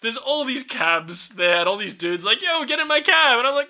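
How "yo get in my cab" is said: calling out. Retold or imitated. Cab drivers calling out.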